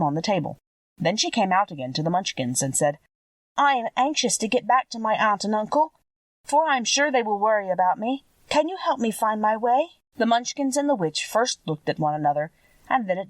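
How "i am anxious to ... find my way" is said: put-on slightly higher, earnest little-girl voice; a hint of a worried child asking for help; lightly voiced young girl, anxious. A narrator's reading. Dorothy begging the Munchkins to help her home, lightly anxious child voice.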